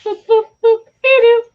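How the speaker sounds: very high, loud Betty Boop squeal. Acted. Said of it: "a really great Betty Boop impression".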